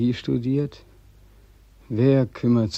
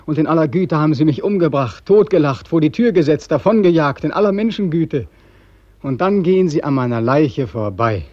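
bitter shouting; 5 semitones higher, 7 dB louder than usual. Acted. Beckmann's bitter tirade about people.

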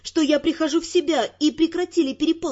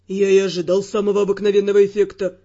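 much lower male voice, calm and grim; calm, gloomy Ferrant; 7 semitones lower, 4 dB louder, faster than usual. Acted. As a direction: answer steadily, darkly. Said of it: "говорил намного более спокойно".

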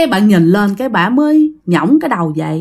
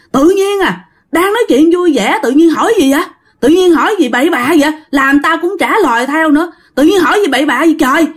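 higher-pitched, quick mock telling-off; retold scolding; 6 semitones higher, faster than usual. Friend scolds another for asking about a taboo subject, scolding. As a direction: scold a friend, pitched up but not truly shouting. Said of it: "cái bạn mới la".

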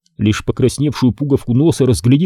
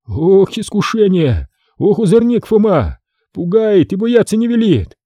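sighing townsman; 9 semitones higher than usual. A narrator's reading. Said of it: "Вздыхал ему вслед".

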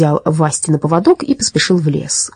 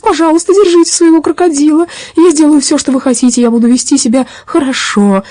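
high, whimpering frantic plea; begging; 10 semitones higher, 4 dB louder than usual. A narrator's reading. Robber begging policeman to hold the dog, pleading. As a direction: plead for mercy in a strained high voice. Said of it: "стал умолять Хотценплотц".

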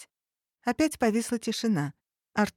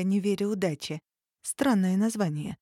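ordinary delivery

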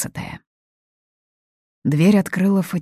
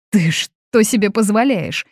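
raised, loud indignant cry; mild shriek; 7 semitones higher, 7 dB louder than usual. A narrator's reading. Mother is outraged at the guest's insolence, mild shriek. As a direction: squeal it in outrage, held back a little. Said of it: "завизжала Фатини".

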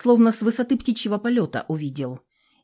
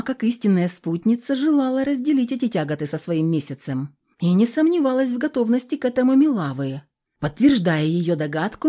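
usual speaking voice

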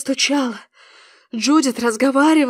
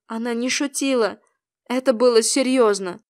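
ordinary delivery, nothing marked

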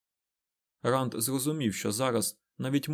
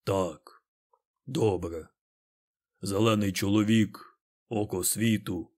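ponderous Ogier; 2 semitones lower, slower than usual. A narrator's reading. Loial finally answers about the Green Man, ponderous.

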